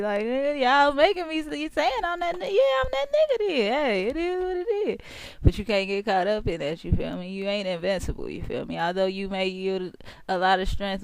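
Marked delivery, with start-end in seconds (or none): 0.2-5.0 high-pitched mock boast of a proud Scorpio; hammed-up bragging in a raised voice. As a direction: play up the swagger, voice climbing very high. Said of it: "they be so proud to be Scorpios".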